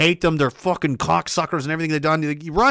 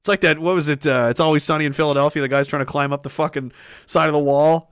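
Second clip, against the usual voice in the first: normal talking voice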